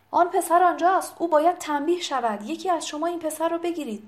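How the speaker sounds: high, quick accusing cry; گودلفین shouting. A narrator's reading. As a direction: call out to have someone seized. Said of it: "متوجه دونا شد و فریاد زد". (فریاد تند با صدای زیر)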